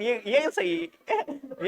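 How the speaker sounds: drawn out through a laugh, slow; can't stop laughing while insisting it's right; laughing insistence. Real, not acted. (हंसते हुए धीरे से)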